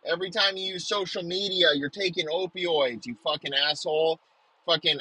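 usual speaking voice

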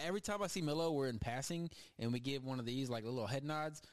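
normal talking voice

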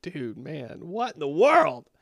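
ordinary delivery

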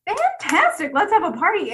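a loud, high sarcastic party call; sarcastic glee. Retold or imitated. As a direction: cheer like the rules are a party invite. Subconscious mocks the food rules.

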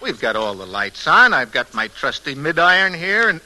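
ordinary talk

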